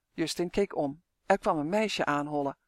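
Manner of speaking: ordinary delivery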